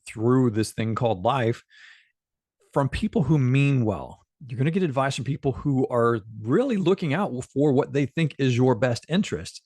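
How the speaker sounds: ordinary talk, nothing marked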